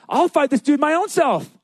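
high-pitched, indignant declaration; comic bravado; defiant boast. Retold or imitated. David volunteers defiantly.

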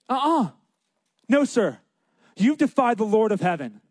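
high-pitched, firm comeback; sassy defiance; defiant refusal. Retold or imitated. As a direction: act out indignant refusal to a bully. David defies Goliath.

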